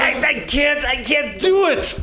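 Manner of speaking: high, whiny hammed-up hero; bad acting. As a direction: play a terrible actor's panic. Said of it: "I'm Ben Affleck. I'm a terrible actor".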